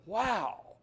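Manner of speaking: high, loud delighted wow; amazed wow. Retold or imitated. Man marvelling that God came through, high excited wow. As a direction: marvel out loud at your luck.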